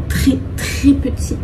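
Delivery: très, très petit drawn out slowly in a breathy half-whisper; emphatic très très. Real, not acted. Showing viewers her tiny room, slow hushed emphasis. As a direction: lean hard on each très, slow and hushed.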